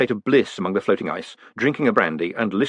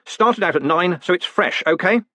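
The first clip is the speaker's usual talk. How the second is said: brusque, higher-pitched growl; 8 semitones higher than usual. A narrator's reading. Postmaster vouches for fresh mail to a clerk, growling. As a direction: push it at him impatiently. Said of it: "he growled".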